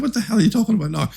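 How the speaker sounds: high, quick incredulous outburst; fast, high mock exasperation; mock bafflement. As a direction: rattle it off high-pitched, as if baffled. Acting out reaction to AI tangent.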